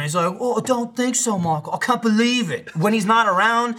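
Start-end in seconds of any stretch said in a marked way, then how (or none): 0.4-2.8 lightly pitched-up impersonation; Gavin. Acted. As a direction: imitate a disbelieving friend, higher. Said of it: "I have to impersonate him".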